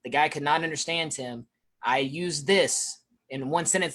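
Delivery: ordinary talk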